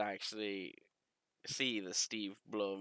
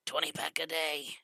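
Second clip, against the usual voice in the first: higher, raspy heavy-smoker voice; 7 semitones higher, 4 dB louder than usual. Acted. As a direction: put on a smoker's rasp, pitched up. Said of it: "Like he's been smoking".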